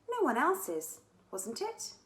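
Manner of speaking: usual speaking voice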